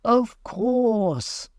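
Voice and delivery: high, slow solemn bird voice; pompous dodo answering gravely. A narrator's reading. Dodo gravely answering the mouse, high and solemn. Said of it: "the dodo replied very gravely".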